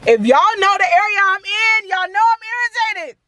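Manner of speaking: high, loud mock-irritated rant; play-shout your irritation at a high pitch; joking outburst. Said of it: "I'm joking".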